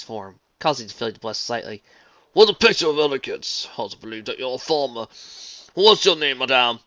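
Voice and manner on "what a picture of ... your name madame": refined stallion voice, admiring; dapper gentleman voice. A narrator's reading. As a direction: admire her gallantly. Marmalade voice.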